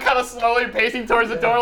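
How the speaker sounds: ordinary talk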